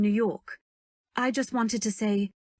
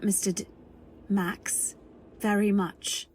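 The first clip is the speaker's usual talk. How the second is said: ordinary talk, nothing marked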